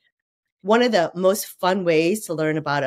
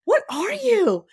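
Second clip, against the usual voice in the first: puzzled taxi driver; 14 semitones higher, slower than usual. Retold or imitated. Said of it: "He's like".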